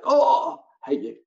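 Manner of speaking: high, loud "Oh" dropping away; imitated outraged reaction; mock indignant students. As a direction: play a class groaning at being tricked. Students react to page-turn trick.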